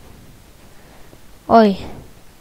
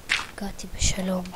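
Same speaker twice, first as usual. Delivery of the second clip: whisper to self; 12 dB quieter than usual. Real, not acted. Whispers to himself while playing.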